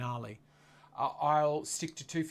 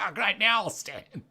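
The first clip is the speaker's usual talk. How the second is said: sarcastic mock; 7 semitones higher, 5 dB louder than usual. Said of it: "You're like".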